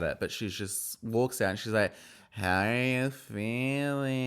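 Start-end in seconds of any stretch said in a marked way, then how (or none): 2.3-4.2 drawn-out, creaky greeting; slow vocal-fry impression of a castmate; imitated vocal fry. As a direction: drag it out slowly in mock vocal fry. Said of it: "she's developed some vocal fry".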